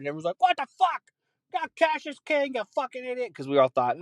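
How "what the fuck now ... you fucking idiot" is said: high, indignant squawk; mock an old man yelling about cash; angry old customer.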